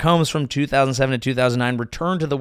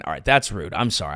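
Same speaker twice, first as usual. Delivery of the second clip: normal talking voice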